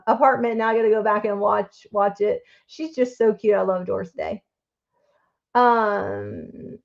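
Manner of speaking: ordinary talk, nothing marked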